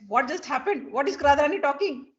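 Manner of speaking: mildly hammed-up confusion; perplexed. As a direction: act out a bewildered servant. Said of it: "she's perplexed".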